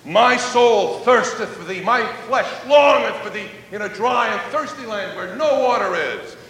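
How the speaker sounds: psalm cried out from the pulpit; fervent preaching. Acted. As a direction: proclaim it with full fervour.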